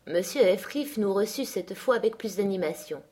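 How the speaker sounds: usual speaking voice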